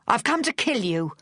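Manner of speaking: high, furious rush of breath. A narrator's reading. Charles threatening Vic with a gun, furious. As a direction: let it burst out in anger. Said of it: "on a furious rush of breath".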